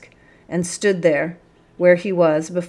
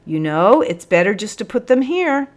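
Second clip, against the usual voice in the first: quickly; 4 semitones higher, 5 dB louder than usual. A narrator's reading. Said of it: "said the corporal quickly".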